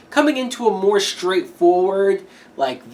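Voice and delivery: normal talking voice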